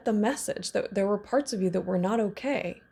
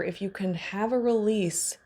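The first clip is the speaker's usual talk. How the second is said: ordinary talk, nothing marked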